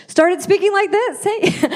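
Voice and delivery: high, drawn-out mock American accent; put-on American accent. Shown as a demonstration. Bullied Irish kid showing off the new voice, put-on American accent. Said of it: "Got made fun of for my accent".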